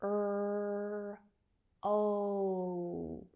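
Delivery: Er, then oh, each held long on its own; sustained, even Er ... oh sound demo; isolated sounds. Shown as a demonstration. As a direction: break Roll into a long Er and a long oh. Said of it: "Some tricky consonant sounds here".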